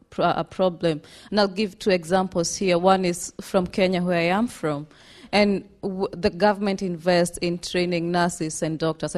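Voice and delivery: usual speaking voice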